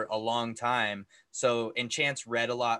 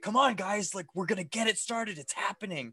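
excited urging; 7 semitones higher than usual. Retold or imitated. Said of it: "we're like".

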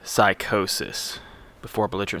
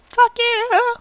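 mock shout; 9 dB louder than usual. Jokey sign-off insult.

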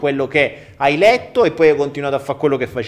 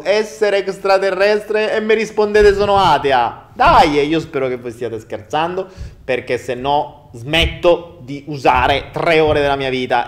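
exasperated rant; 4 semitones higher, 9 dB louder than usual. Real, not acted.

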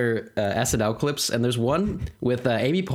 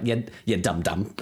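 put-on Bronx accent; 4 semitones lower than usual. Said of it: "get that accent down".

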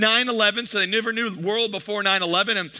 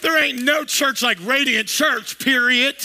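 slow, higher, louder, with "period" shouted; emphatic declaration; 4 semitones higher, 3 dB louder, slower than usual. Shown as a demonstration. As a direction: hype the church and land "period" hard. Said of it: "statement emphasizer".

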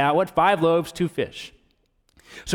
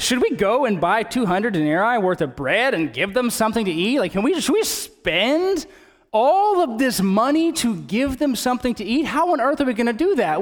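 high-pitched disbelief, pitch swinging widely; acting out baffled, protesting disciples; incredulous protest; 7 semitones higher than usual. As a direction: balk at an absurd request. Disciples protest the cost of bread.

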